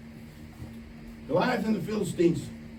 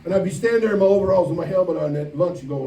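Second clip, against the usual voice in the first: normal talking voice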